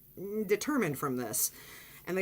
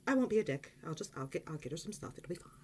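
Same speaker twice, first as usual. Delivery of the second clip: softer and softer down to a whisper; muttering to herself; 2 semitones lower, 5 dB quieter, faster than usual. Retold or imitated. Narrator quietly talking herself out of being rude. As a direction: talk yourself into staying calm. Inner resolve, fading.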